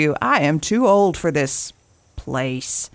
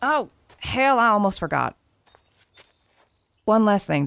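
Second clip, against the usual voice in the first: normal talking voice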